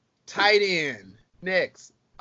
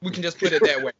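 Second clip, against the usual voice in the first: usual speaking voice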